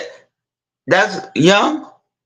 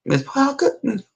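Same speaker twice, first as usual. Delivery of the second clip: higher, softer questioning call; 3 semitones higher, 5 dB quieter than usual. Retold or imitated. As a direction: coax her name gently. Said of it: "like this".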